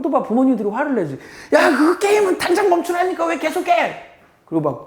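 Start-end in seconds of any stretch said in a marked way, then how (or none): 1.5-3.9 much higher mock shriek, laughing; furious parent, laughing. Parent yelling at a child who won't stop gaming, shrill and laughing. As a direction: shriek at the kid, half laughing.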